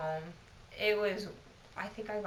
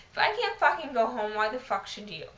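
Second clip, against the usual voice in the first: louder, indignant comeback with punched swearing; angry retort; 8 dB louder than usual. Retold or imitated. Telling the coworker she can't go home either, loud and angry. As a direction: fire it back at her.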